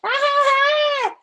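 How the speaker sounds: high, loud screaming cackle; scariest scream. Acted. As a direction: scream it out to spook grandma. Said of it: "I screamed".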